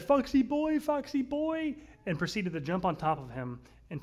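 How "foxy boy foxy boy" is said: put-on squeaky high little-girl voice, much higher; toddler voice. Big sister greeting her newborn brother, high toddler squeal. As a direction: sing it out like a delighted toddler. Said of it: "she went up to him and said".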